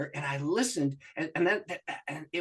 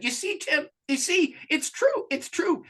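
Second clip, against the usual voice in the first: excited wife; 12 semitones higher, 4 dB louder, slower than usual. Retold or imitated. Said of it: "and she said".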